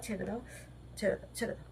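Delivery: soft whispered please let go; hushed pleading. Real, not acted. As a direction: quietly beg the pet to let go. (ফিসফিস করে অনুনয়)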